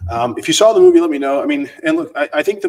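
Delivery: loud, eager call to the chat; pumped up, inviting viewers in; excited. Real, not acted.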